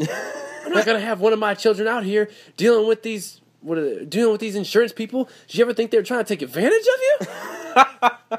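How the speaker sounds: high-pitched indignant mother impression; ad's scolding mom. Mom in a lawyer ad fuming about insurance people, indignant mock scolding. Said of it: "with the mom".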